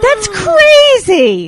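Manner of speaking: loud, slow, high cry of disbelief; excited shout. Real, not acted. Exclaiming in disbelief.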